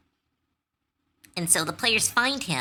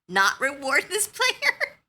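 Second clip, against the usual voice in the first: high, slow laughing disbelief; 9 semitones higher, 3 dB louder, slower than usual. Real, not acted. DM laughing as a player hands over the books. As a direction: laugh it out helplessly.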